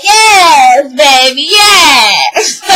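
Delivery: drawn-out gleeful cheer; Cookie loving it. Retold or imitated. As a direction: whoop it like a delighted supporter. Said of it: "Cookie's over there loving it".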